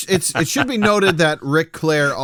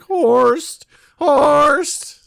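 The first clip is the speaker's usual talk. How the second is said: high, loud drawn-out call; mock cheer; 12 semitones higher, 6 dB louder than usual. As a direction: call out the name like a running in-joke. Group's running in-joke cheer.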